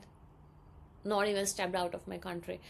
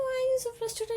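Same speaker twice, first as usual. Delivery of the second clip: high, louder mock brother voice; brother's question; 4 dB louder, much faster than usual. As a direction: play the brother, high and loud. Brother asks why she's upset.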